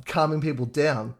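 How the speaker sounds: usual speaking voice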